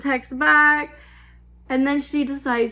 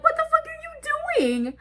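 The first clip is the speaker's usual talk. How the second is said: much higher, stunned squeak; floored by what she just heard; shocked disbelief. Retold or imitated. To the sister at the girlfriend's house, shocked and high.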